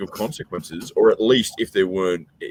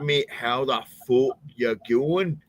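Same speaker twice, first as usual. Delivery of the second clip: slow, higher-pitched Glasgow brogue; Glaswegian accent, slowed down; 5 semitones higher, slower than usual. Retold or imitated. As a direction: repeat it slowly, still thickly Glaswegian. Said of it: "slow down. Can you, can you say it again?".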